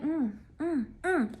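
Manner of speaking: acted disapproving hums; suspicious humming. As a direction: hum quietly, stewing on it. Reacting to location switched off.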